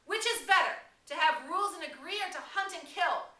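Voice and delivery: very high, loud challenge; shout. Acted. Piggy shouting his question over the clamor. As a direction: hurl the question at them. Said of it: "Piggy shouted again".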